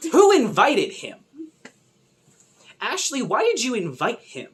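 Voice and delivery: high, loud outraged call; outraged shout at screen. Real, not acted. Fan yelling at Ashley on screen, high and loud.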